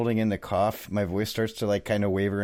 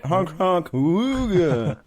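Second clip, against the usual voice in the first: zany sound effects, pitched up; silly clown horn; 8 semitones higher, 4 dB louder, much slower than usual. Pretend. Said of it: "all the stupid sound effects".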